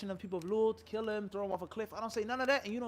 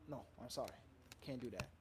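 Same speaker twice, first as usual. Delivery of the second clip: low, soft, understated refusal; quiet refusal; 7 semitones lower, 12 dB quieter, faster than usual. Acted. Parent refusing to let children go with uncle, quietly. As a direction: turn it down quietly, pitch dropped.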